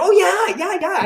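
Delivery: high, eager fake yes; bluffing assurance. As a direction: overeager yeses to cover a lie. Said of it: "I lied in the moment".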